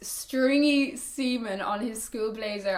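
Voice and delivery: normal talking voice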